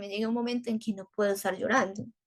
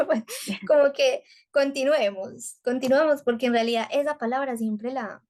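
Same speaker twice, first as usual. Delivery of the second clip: ordinary delivery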